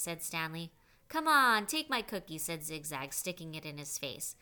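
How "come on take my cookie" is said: sudden loud jeering push, pitched up; loud taunt. A narrator's reading. Zigzag jeering at Stanley, loud and pushy. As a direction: shove the offer in his face, loud.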